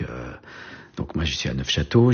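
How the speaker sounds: ordinary talk, nothing marked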